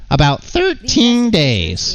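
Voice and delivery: high, drawn-out cartoon voice; earnest yacht dreamer. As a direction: stretch it out in a squeaky character voice. Same character answers about dream.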